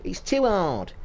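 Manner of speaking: mocking the moaning pros; mock whining. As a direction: whinge it mockingly. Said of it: "all the fuckers have pissed and moaned".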